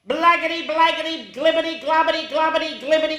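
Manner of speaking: high, pattering gibberish; silly sounds. A narrator's reading. Reader forced into silly sounds, high babbling. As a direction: rattle the nonsense off in a high voice. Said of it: "make silly sounds like".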